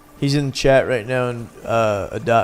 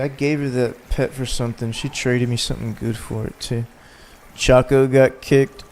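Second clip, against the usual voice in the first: ordinary delivery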